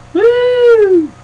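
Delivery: very high, loud victory whoop; excited woo. Real, not acted. Celebrating his Patriots winning the Super Bowl with a whoop. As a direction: let out a big victory cheer. Cheering his team's win.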